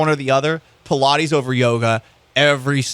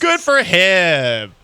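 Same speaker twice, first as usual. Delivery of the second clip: mock solemn; 3 semitones higher, 3 dB louder, much slower than usual.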